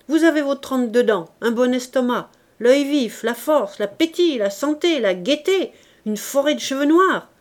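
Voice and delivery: loud, high, mounting list; indignant tirade. A narrator's reading. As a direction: hurl each item like an accusation. Indignant old man lists youth's riches.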